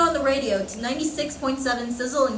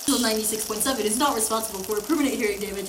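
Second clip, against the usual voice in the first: fast disclaimer; 3 semitones lower, 7 dB quieter, faster than usual. Acted.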